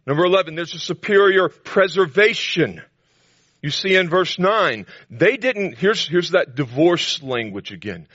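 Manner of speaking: high and loud, hammering the promises; emphatic preaching. Acted. Preacher to the congregation, insisting high and loud. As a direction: drive home every "I will".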